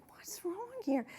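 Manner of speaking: a hushed mock whisper of confusion; puzzled whisper. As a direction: whisper it to yourself, lost. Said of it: "And I'm like".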